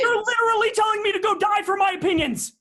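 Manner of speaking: shouting in disbelief; outraged shout. Acted. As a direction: yell it back, outraged. Outraged reply in quarrel.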